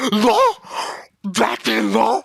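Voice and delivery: high, loud disbelieving question; shocked outburst. Real, not acted. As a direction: shout it in amazed disbelief. Shocked at the match-up.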